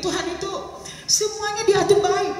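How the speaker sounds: rising earnest stress; earnest emphasis. Real, not acted. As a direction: press the point home with conviction. Speaker's earnest point about God.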